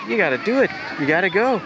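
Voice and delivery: normal talking voice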